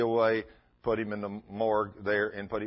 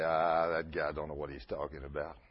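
scoffing; 8 semitones lower, 3 dB quieter than usual. Retold or imitated. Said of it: "Most of them say".

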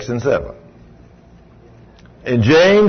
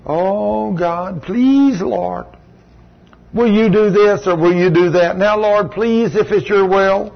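high, whiny imitation of a begging prayer; mock the timid pray-er; mock pleading prayer; 6 semitones higher, slower than usual. Preacher mocking weak faith, higher whiny pleading prayer. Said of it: "Well, if you".

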